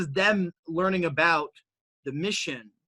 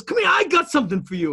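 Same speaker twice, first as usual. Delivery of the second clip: high, louder call with a promise; owner calling; 11 semitones higher, 5 dB louder than usual. Retold or imitated. Owner calls him in to hand him a gift, high and louder. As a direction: beckon him in, a bit mysterious. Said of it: "he goes".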